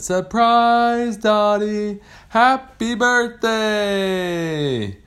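high, loud, long drawn-out shout; birthday cheer. Acted. Sharks greeting the birthday shark, stretched joyful cheer. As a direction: call it out like a whole crowd.

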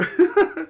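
high loud burst of laughter; a quick disbelieving cackle; sharp laugh. Real, not acted. Sudden laugh mid-rant.

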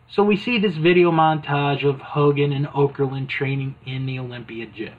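ordinary talk

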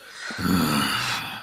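long, heavy acted sigh; drawn-out exhale of resignation; grudging sigh. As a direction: sigh in reluctant surrender. Dwarf gives in grudgingly.

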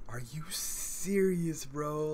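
can't believe your eyes; incredulous. Retold or imitated. Fan retelling the missed tackle to a friend, in slow high disbelief.

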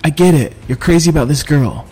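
lightly voiced exasperated friend; Jack scolding. A narrator's reading. Jack chiding the narrator about the princess, exasperated.

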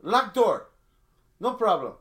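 a hint of the club owner's broken English, loud. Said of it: "He goes".

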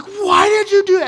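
high, loud furious demand; angry yell. Retold or imitated. Dad yelling at son after the kick, furious. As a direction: yell it at your son in rage. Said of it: "and I was like".

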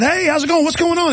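high, sing-song overly friendly hello; bright forced politeness; mock friendly greeting. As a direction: sweeten it up to charm the cop. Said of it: "I said".

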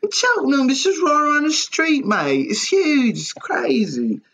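hammed-up British bloke, pitched high; mock British accent. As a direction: play an over-the-top Brit, amazed at a bus. Mock British bloke about a bus.

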